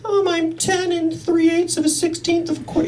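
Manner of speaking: put-on high, evasive little kid voice. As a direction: play a kid dodging a straight answer. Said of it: "He's like".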